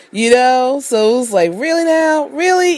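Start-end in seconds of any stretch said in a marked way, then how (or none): 1.6-2.7 high-pitched disbelieving questions; mock incredulous. Retelling the reaction to wanting out, mock incredulous. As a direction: imitate someone who cannot believe this.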